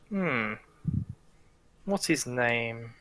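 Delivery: quiet, slow menacing question; soft probing villain. Acted. Villain quietly asks Cassandra a name, softly menacing. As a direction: lean in and ask softly.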